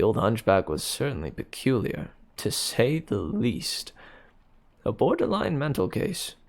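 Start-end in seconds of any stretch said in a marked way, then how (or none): none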